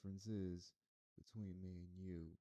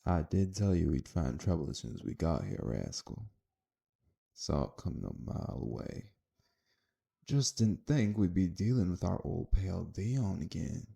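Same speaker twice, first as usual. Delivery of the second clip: loud taunt; 18 dB louder than usual. Acted. Gunman mocking Dion with partner.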